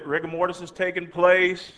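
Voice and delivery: ordinary delivery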